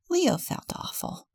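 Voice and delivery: usual speaking voice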